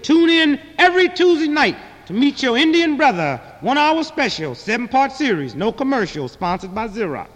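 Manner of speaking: mock promo voice, starting high and settling down; TV announcer. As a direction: announce it like a television special. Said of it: "you hear the announcer say".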